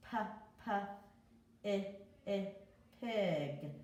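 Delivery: p, i, i, pig, each sound apart. Shown as a demonstration. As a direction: break pig into its sounds for kids. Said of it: "That's the short I sound".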